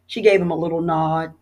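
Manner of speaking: usual speaking voice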